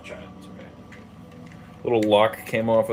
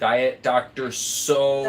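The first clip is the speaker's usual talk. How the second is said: drawn out slowly; 2 semitones higher, 5 dB louder, slower than usual. Pretend. Savoring the silly name.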